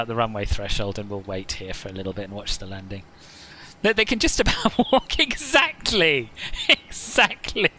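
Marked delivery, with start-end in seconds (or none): 3.8-7.7 high, helpless laugh through the words. Real, not acted. Streamer cracking up at his viewers, laughing high as he talks. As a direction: squeal it out through laughter, drawing out the last words.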